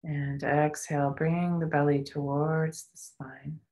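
guided meditation. Acted. Leads a breathing meditation.

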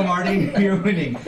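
ordinary talk, nothing marked

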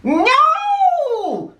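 high, drawn-out cry of refusal; sudden high-pitched witch's cry. A narrator's reading. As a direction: shriek it out high. Witch answers the threatening dragon.

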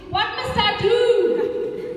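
high, loud, very slow bewildered dad; loud drawn-out helpless plea; helpless father. Retold or imitated. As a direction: play the lost father pleading for help. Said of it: "he goes to the baby and he says".

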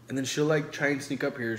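normal talking voice

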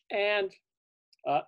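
plain reading voice

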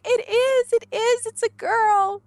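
very high, loud and slow delighted cry; mother announcing it's a girl; thrilled. Retold or imitated. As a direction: burst out in joy.

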